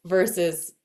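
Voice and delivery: normal talking voice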